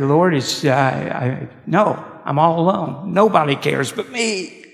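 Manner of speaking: mild anguished protest; same despairing prayer. Retold or imitated. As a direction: whine it out to God, lonely. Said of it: "he's got the same prayer".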